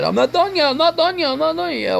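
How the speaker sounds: high-pitched mock protest; mocking mechanic. Mechanic retorting to a customer pointing out bad wiring, high mocking imitation. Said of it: "And he said".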